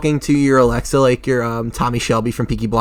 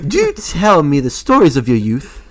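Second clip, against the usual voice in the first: mock British accent; 2 semitones higher, 4 dB louder than usual. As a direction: put on a leisurely British accent. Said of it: "talk in a British accent".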